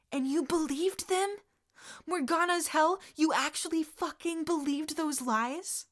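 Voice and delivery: high, loud disbelief; furious disbelief at him; incredulous shout. Acted. Sirius outraged that Regulus believed it, high incredulous shout.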